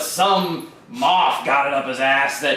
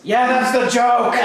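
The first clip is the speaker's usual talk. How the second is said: laughing outburst; 4 semitones higher than usual. Acted. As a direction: crow it and burst out laughing. Droid laughs at own joke.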